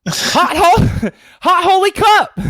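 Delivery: loud, high infomercial hook; first take, laughing as it breaks down; hyped ad opener. Acted. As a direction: sell the product name loud and bright. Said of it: "Take two".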